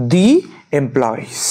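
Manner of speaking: long "ee" in the before employees; "the" as "dee". Shown as a demonstration. Teacher models the vowel rule, the as "dee". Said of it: "এখানেও vowel আছে".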